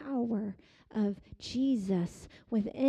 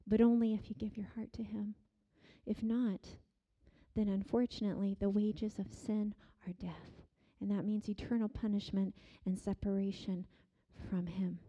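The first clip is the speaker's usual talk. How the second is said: low, soft solemn warning; hushed warning; 5 semitones lower, 8 dB quieter, faster than usual. Acted. Preacher warns congregation of judgment, hushed and low. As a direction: drop to a grave hush.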